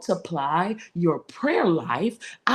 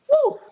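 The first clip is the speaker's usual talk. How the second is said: joyful whoop; 7 dB louder than usual. Real, not acted. Preacher erupts mid-sermon.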